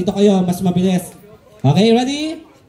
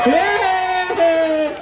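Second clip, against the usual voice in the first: cheer; 7 semitones higher than usual. Real, not acted. Host cheers a winning contestant.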